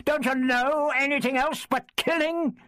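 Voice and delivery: pitched high with outrage, words ringing out; storming on. A narrator's reading. Doctor raging at Leicester over killing, high and outraged. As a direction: fling the question at him in fury. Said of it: "he stormed".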